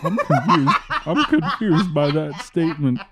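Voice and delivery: laughing. Real, not acted. Laughing at anime girl remark.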